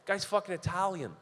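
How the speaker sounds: usual speaking voice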